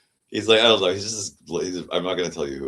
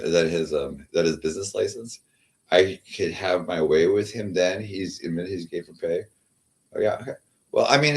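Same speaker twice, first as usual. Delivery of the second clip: usual speaking voice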